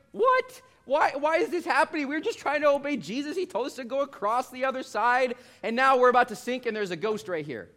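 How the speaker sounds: high, loud, frantic cry; freaking out. Retold or imitated. Preacher acting disciples freaking out in the storm, high frantic cry. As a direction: shriek like terrified men in a sinking boat. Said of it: "they're freaking out".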